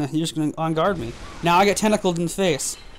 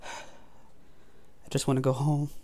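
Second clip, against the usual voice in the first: soft, slow, sighed-out murmur; defeated sigh; 3 semitones lower, 10 dB quieter, much slower than usual. Real, not acted. Gamer giving up after another death, in a soft slow sigh. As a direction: let the air out, beaten and done. Beaten again by the boss.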